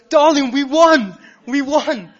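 high, loud, drawn-out cry of victory; ecstatic shout. Retold or imitated. Man shouting to the stranger with the trolley, thrilled. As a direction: yell it out to her across the queue. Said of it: "he turns around. He".

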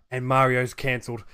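ordinary talk

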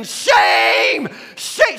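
loud, high mock shriek; screaming old lady. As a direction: imitate her screeching accusation at full voice. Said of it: "'cause she's screaming".

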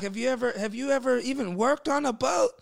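high, loud exasperated questioning; retold freak-out. Boss firing the fake captain, freaking out. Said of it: "dude was freaking out".

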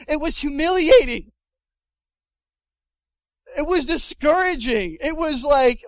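loud, high, aggrieved outburst; hammed-up wounded protest; comic indignation. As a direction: bewail the shame at full voice. Mock outrage at the memory.

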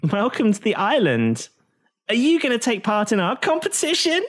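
an anime hostess beaming at newcomers; anime girl voice. Acted. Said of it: "they're anime characters".

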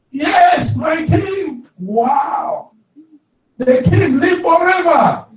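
high, loud triumphant reply; joyful shout. Acted. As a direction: shout up from the pit with joy. Daniel answers from the pit.

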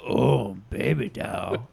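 low, drawn croc voice; Killer Croc voice. Shown as a demonstration. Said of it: "Say that".

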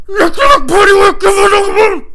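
frenzied high-pitched shriek; hammed-up screaming chant; screamed nursery rhyme. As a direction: scream the rhyme like a crazed fight anthem. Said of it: "He just started singing nursery rhymes".

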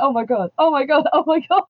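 a hint of a thrilled fan gushing; rising, lightly voiced fan excitement; excited fans. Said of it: "I love that moment of, like".

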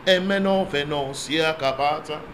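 soft steady prayer in tongues; speaking in tongues. Real, not acted. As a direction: pray quietly in the spirit. Praying in tongues.

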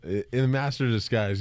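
normal talking voice

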